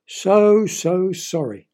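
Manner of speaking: slow, mild mock sob; sobbing. Emma apologizing to the neighbours, slow mock sob. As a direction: plead through mock tears. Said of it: "she sobbed".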